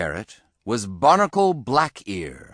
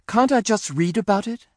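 high, quick little bunny voice; pleading bunny son; 11 semitones higher, faster than usual. A narrator's reading. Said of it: "asked Henry".

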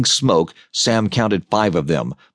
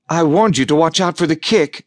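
a hint of a smile in the voice; lightly teasing told-you-so; wry amusement; 6 semitones higher than usual. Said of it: "the faintest hint of a smile".

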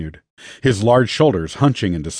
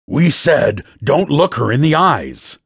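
raised, higher scolding voice; angry shout; 5 semitones higher than usual. A narrator's reading. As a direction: scold him in a contained shout. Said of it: "as he shouted".

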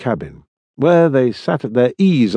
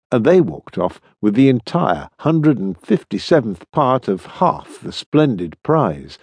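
ordinary delivery, nothing marked

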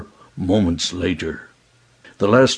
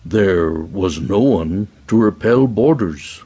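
usual speaking voice

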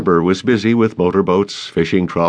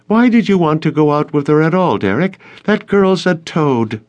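mock him in a raised pitch; 10 semitones higher, 4 dB louder than usual. A narrator's reading. Crystal needles Derek about the girl who refused him, mocking. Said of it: "Crystal said in a mocking voice".

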